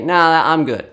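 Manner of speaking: mock casual refusal; reluctant adults. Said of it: "adults will normally say".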